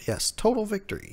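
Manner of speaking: mock triumphant. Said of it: "It's a total victory for Kaido-sama".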